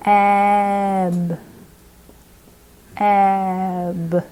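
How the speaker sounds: "Ebb" as one long unbroken glide; blended sounds. Shown as a demonstration. Teacher runs a finger across, blended sounds. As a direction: hold the "eh" long and run it into "b". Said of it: "blend them together so there's no break".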